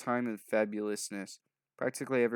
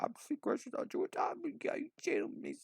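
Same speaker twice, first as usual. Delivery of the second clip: squealing fangirl. Acted. Excited fan gushing at Sasha.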